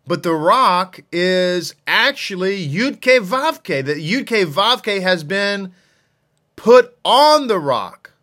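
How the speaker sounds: usual speaking voice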